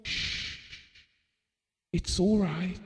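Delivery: soft shush, then gentle words; quiet comfort at night; soothing hush. Retold or imitated. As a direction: hush her like a child waking from a bad dream. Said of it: "he'd say".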